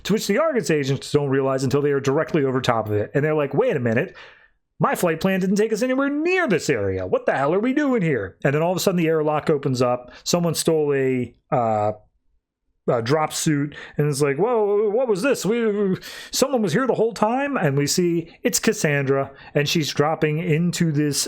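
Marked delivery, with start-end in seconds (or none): 3.5-8.4 mock alarm, pitched up; alarmed agents. Agents finding themselves over a no-fly zone, mock alarm. 14.4-17.6 high-pitched mock surprise; startled discovery. Agents spotting someone who stole a drop suit, startled.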